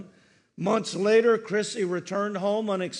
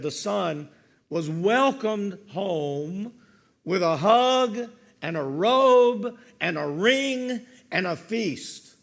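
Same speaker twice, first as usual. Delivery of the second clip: ordinary talk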